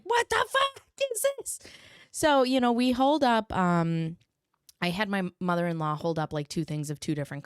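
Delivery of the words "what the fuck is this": much higher, squeaky alarmed; inner panic. Retold or imitated. Mother's silent panic about her daughter's eye. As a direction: freak out inside.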